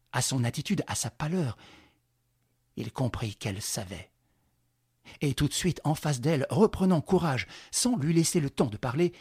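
ordinary delivery

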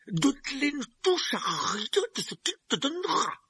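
sharp, high pompous uncle snapping; curt. Acted. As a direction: bark the order at his son. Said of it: "dit sèchement l'oncle Vernon". (ton sec et cassant)

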